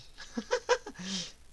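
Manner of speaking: high burst of giggles; amused laugh. Real, not acted. Player amused by the game, high laugh. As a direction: break into a quick laugh.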